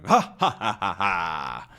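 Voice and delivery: mock villain laugh, each ha separate; acted ghostly laugh. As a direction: laugh like a storybook phantom, fading out. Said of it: "And he laughs".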